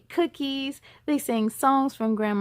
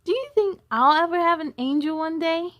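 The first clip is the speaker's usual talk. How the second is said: put-on higher, eager little boy; child voice; 6 semitones higher, 4 dB louder than usual. A narrator's reading. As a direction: ask it like an excited kid. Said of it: "exclaimed B J".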